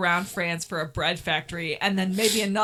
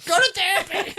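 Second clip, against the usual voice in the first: laughing, tossed-off yell out a car window; mock yelled brush-off; 11 semitones higher, 4 dB louder than usual. Yelling from a car at a crying woman, laughing mock yell. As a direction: shout it while laughing. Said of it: "yelling that out the window".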